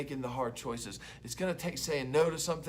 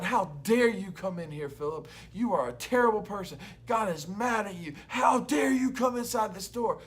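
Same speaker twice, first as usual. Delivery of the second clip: loud, higher indignant mock scolding; accusing enemy; 5 semitones higher, 5 dB louder than usual. The enemy condemning Philip after sin, loud mock scolding. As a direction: hammer him with blame. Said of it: "and he's going".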